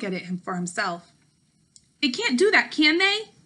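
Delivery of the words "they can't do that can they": higher, louder pleading question; boy hoping he's wrong; worried child's plea; anxious boy. Acted. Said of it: "he asked".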